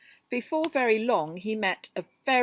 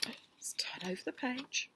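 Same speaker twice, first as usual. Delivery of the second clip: whispered aside; 4 semitones higher, 9 dB quieter than usual. Real, not acted. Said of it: "reader aside".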